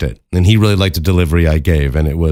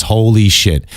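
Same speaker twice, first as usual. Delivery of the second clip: ordinary talk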